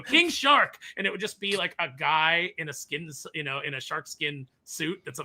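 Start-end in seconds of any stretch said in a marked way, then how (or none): 0.0-0.8 high, loud reveal; excited announcement. Retold or imitated. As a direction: proudly present the name. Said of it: "they'd be like".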